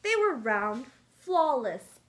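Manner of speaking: lively storybook read-aloud; reading to children. Acted. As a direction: read it like a picture book to kids.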